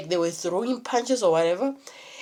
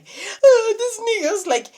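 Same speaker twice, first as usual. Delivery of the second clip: the friend's panicked words, voiced high and loud; high strained quote; 11 semitones higher, 5 dB louder, slower than usual. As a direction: voice jumps much higher and louder for the friend. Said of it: "He's like".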